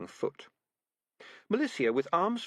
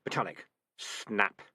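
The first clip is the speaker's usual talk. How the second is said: plain reading voice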